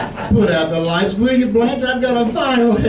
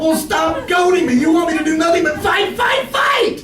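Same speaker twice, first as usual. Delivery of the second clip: yelling; 7 semitones higher than usual. Acted. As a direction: bellow it like a fed-up husband at the end of his rope. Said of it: "nobody told you to yell your brains out".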